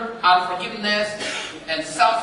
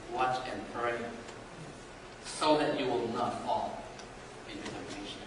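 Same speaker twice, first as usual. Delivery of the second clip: hushed Jesus; 8 semitones lower, 11 dB quieter than usual. Acted. Jesus repeats the warning quietly.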